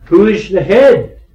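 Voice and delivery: loud, pressing question to the congregation; emphatic question. Shown as a demonstration. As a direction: fire the question out loud, stressing "head". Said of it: "Notice again".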